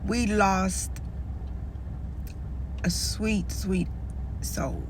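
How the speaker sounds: soft, fading words on the edge of crying; holding back tears. Real, not acted. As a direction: let it drop to almost nothing, near tears. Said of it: "y'all already see these tears".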